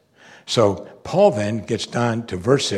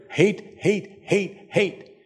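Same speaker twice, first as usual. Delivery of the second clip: mock chant of the hate monger, pitched higher; hate monger dirge; 8 semitones higher, much slower than usual. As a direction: repeat hate like a droning dirge. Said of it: "the dirge of the hate monger".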